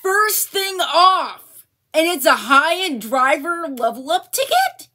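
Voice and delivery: can't believe the luck; incredulous. Real, not acted. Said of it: "I'm in disbelief right now".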